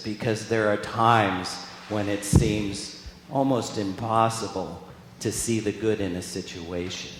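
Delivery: normal talking voice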